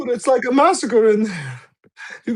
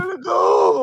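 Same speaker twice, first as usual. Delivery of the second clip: a higher, sudden cry of alarm; panicked call; 8 semitones higher than usual. Real, not acted. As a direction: blurt it out in a panic. Panicked player calling out.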